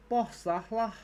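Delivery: hammed-up Scouse cheer; mock Scouse. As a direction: Liverpool lad approving. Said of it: "my terribly worrying Scouse accent".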